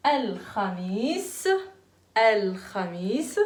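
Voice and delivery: الخميس said slowly with a rasped kh; slow model pronunciation. Shown as a demonstration. Teacher shows children how to say الخميس. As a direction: draw out الخميس, scraping the kh. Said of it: "Attention pour ceux qui prononcent mal le kha".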